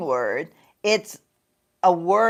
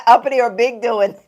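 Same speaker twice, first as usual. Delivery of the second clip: laughing; 5 semitones higher, 7 dB louder than usual. Real, not acted. Reading a viewer comment, amused.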